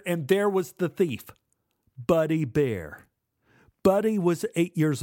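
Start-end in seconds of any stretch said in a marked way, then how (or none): none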